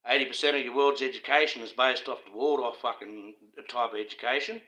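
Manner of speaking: normal talking voice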